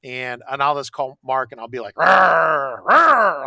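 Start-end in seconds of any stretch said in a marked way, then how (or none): 1.9-3.5 loud rising pit bull growl. Retold or imitated. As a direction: snarl like an angry dog down the phone. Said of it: "I'm growling".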